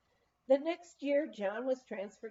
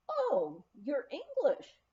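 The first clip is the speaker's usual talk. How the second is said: surprised realization; 6 semitones higher, 3 dB louder, slower than usual. Retold or imitated. Hostess surprised by guests' answer.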